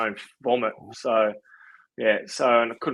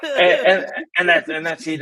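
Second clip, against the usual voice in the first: laughing; 6 semitones higher, 6 dB louder than usual. Real, not acted. Laughing with host.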